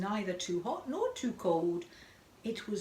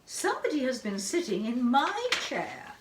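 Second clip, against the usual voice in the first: higher, lighter mother bear voice; lilting and higher, a gentler bear; 4 semitones higher, 7 dB louder than usual. A narrator's reading. Mother Bear noticing her chair was used, gentler voice. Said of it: "in a quiet, gentler voice".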